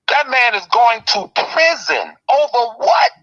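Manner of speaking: ordinary delivery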